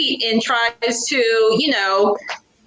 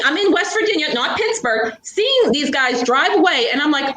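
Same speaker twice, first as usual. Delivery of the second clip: usual speaking voice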